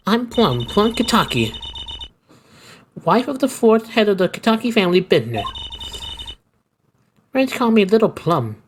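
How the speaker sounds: a hint of a proud gangster wife; old lady voice. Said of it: "some kinda old lady voice".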